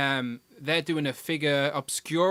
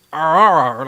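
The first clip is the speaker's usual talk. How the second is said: pirate growl; 3 semitones higher, 11 dB louder than usual. Retold or imitated. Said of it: "like a pirate".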